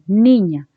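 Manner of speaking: "niña" with a forceful NI; emphatic first-syllable stress on "niña". Shown as a demonstration. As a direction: make the NI of "niña" felt, ÑA dropping. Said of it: "Tienes que sentir la palabra".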